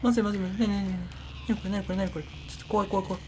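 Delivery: high, breathy, trembling fear; frightened whimper. Real, not acted. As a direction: whimper like you're about to cry. Scared by a sudden scare. (怯えた泣き声)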